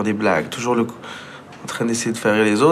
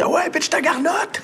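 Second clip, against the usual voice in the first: much higher, slow, exaggerated Québécois shout with a laugh; Québécois slang yell; slower than usual. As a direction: belt out joual slang at recess, laughing. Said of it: "la première fois que j'ai crié ça".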